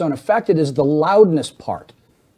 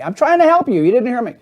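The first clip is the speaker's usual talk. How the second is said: high, loud exasperated insistence; acted yelling; 9 semitones higher, 4 dB louder than usual. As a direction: insist loudly that you're helping, mock quarrel. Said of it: "Why are you yelling at me".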